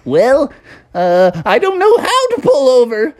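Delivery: high, acted helplessness; mild mock panic; flustered driver. Frumble flustered while driving.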